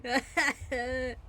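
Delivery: giggling and breaking character; laughing, worn out. Real, not acted. Reader breaks down laughing over the accent. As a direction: laugh through tiredness, then drop into own voice. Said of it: "I'm getting tired of the British accent".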